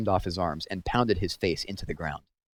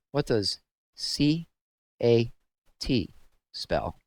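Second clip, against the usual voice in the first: spelling it out; 4 semitones higher, much slower than usual. A narrator's reading. Warden spelling out a word.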